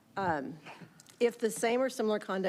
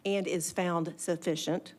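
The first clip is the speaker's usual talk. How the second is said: slow, deliberate dictation; dictating; slower than usual. Real, not acted. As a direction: dictate it word by word. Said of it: "P-, please go slow".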